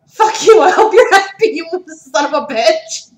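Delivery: loud, high joking yell; shouting. Real, not acted. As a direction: yell at the friend, half joking. Yelling at friend who dared her.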